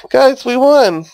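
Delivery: high, thrilled victory shout; excited cheer. Real, not acted. Celebrating the victory.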